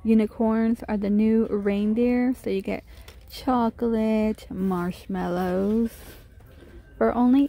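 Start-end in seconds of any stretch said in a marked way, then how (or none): none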